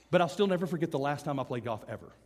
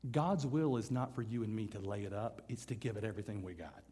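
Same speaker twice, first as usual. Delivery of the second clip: low, hushed preaching; solemn; 5 semitones lower, 6 dB quieter than usual. Real, not acted. Preacher tells congregation God's will, solemn and hushed. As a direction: drop the voice low and soft for weight. Solemn application after loud point.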